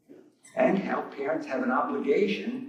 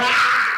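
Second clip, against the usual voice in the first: loud, higher mock scream of fright; retold scream; 7 semitones higher, 8 dB louder than usual. Telling the congregation how he yelped at the gator, a loud mock scream. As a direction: yelp it like you just jumped out of your skin. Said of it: "I was screaming".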